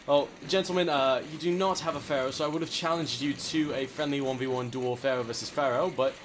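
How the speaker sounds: mock gentleman. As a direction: greet them like a courtly English gent. Challenges to a duel, gentleman voice.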